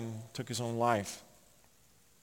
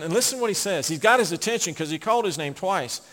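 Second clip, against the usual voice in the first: ordinary talk, nothing marked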